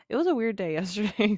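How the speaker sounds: normal talking voice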